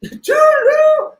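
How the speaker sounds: very high, slow, loud falsetto; Mrs. Doubtfire voice. Retold or imitated. Quoting Mrs. Doubtfire, high falsetto farewell. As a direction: trill it like an old nanny. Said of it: "Robin Williams plays a character".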